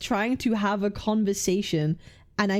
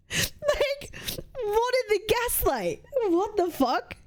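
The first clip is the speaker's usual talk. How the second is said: high, breaking into disbelieving laughter; squeaky laugh of pure disbelief at the accusation; incredulous laugh; 10 semitones higher, slower than usual. Real, not acted. Disbelief at being told off.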